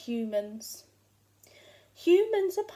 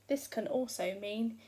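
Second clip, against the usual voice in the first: ordinary delivery, nothing marked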